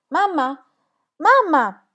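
put-on girl running upstairs calling her mother; frantic calling. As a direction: search the empty house calling her name. Searching house for silent mother.

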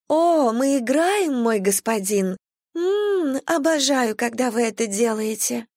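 high, slow, playful coo. A narrator's reading. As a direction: coo it like a thrilled seductress. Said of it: "обрадовалась она". (игриво и восторженно, высоким голосом)

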